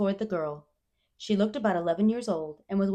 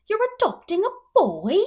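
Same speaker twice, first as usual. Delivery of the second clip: astonished repeat; 13 semitones higher, 10 dB louder than usual. A narrator's reading. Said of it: "she repeated".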